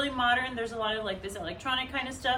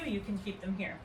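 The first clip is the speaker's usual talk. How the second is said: ordinary delivery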